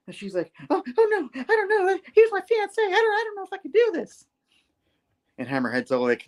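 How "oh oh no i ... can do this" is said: very high, hammed-up flustered squeal; panicked. Janice told to kill her fiancé, mock panicked.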